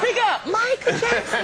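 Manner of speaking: very high, drawn-out call; comic demand. Retold or imitated. Mocks the too-quiet phone caller.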